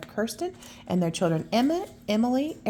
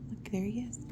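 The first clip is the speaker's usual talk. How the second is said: usual speaking voice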